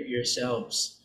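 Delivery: ordinary talk, nothing marked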